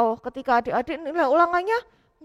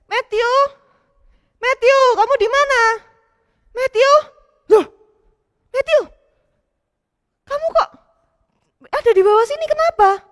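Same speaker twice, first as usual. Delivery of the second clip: raised, worried searching call; father calling out; 9 semitones higher, 6 dB louder than usual. Acted. Papa searching for Matthew, calling out worried. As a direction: look for your son and call his name.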